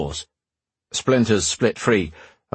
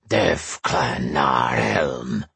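deep, slow, rough-as-gravel barbarian voice; rough gravelly barbarian; 8 semitones lower, much slower than usual. A narrator's reading. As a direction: grind each word out like gravel. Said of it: "the words as rough as gravel".

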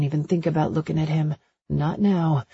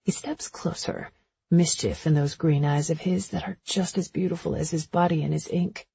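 ordinary delivery, nothing marked

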